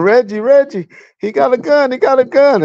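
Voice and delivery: high-pitched retold holler, Reggie's name called out. Prostitute warning Reggie about the gun, high holler. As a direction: mimic her shouting a warning at him. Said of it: "She started hollering".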